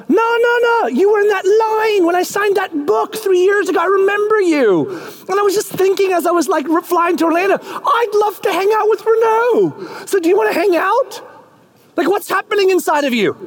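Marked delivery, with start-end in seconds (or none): 0.1-11.2 author inviting the fan to lunch; eager celebrity. Acted. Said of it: "And they're like".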